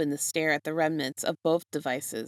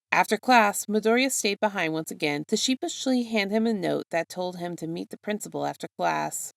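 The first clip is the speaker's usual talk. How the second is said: ordinary delivery, nothing marked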